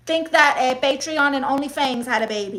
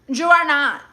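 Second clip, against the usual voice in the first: ordinary delivery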